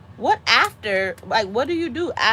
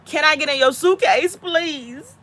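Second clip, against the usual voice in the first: high, whiny begging; wheedling, pitched-up plea; mock pleading; 5 semitones higher, 3 dB louder, slower than usual. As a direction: beg like a clingy parent, hammed up. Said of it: "I'm gonna be like".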